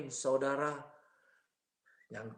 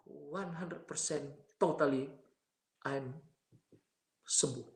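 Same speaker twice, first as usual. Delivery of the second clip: usual speaking voice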